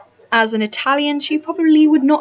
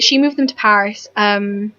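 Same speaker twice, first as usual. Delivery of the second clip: ordinary talk, nothing marked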